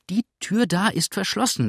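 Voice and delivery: high, vexed call; annoyed landlady calling out; really annoyed. Acted. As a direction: insist crossly on the locked door. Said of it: "rief Misses Bridleford jetzt wirklich ärgerlich".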